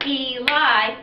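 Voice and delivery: Eli split into E-li, one clap per beat; two even claps for Eli; clapping syllables. Shown as a demonstration. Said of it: "when you clap it out".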